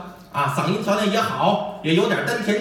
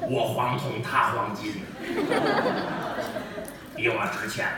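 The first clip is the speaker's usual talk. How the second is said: soft, low laugh through the words; chuckling old official; 7 semitones lower, 6 dB quieter than usual. Acted. Official jokes about the vendor's name, chuckling softly. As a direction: laugh to yourself over the name.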